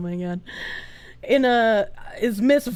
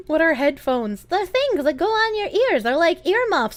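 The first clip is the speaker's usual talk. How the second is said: ordinary delivery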